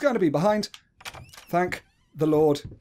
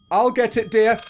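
high, loud mock-domestic call; put-on high spouse voice; mock doting spouse; 9 semitones higher, 6 dB louder than usual. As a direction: call out sweetly to a pretend spouse. Mock spouse answering the phone.

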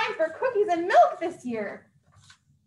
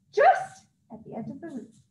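usual speaking voice

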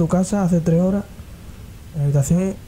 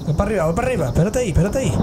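normal talking voice

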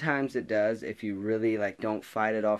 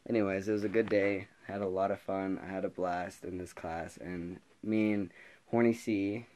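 normal talking voice

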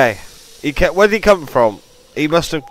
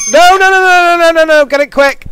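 much higher and louder desperate pleading; frantic no no no; 13 semitones higher, 8 dB louder than usual. Real, not acted. Gamer about to be caught, frantic high screaming. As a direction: scream the noes in real panic.

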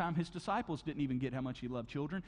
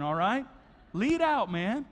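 ordinary talk, nothing marked

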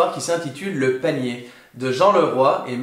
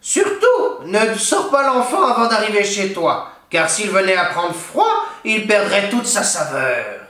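higher, crafty crone voice; sly witch warning; 5 semitones higher, 3 dB louder than usual. A narrator's reading. Witch tricking the ogre, sly warning crone voice. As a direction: trick the ogre with mock advice. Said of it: "la vieille dit au monstre".

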